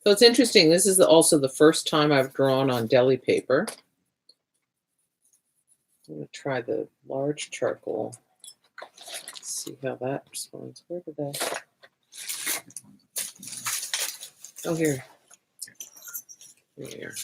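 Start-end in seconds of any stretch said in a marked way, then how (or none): 6.1-17.1 muttering to herself under her breath. Real, not acted. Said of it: "Sorry, I'm muttering".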